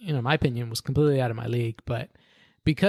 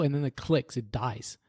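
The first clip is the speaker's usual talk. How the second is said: ordinary talk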